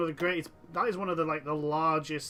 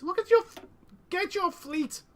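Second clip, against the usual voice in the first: exasperated yell; 13 semitones higher, 3 dB louder than usual. Real, not acted. Angry at ally's idle fleet.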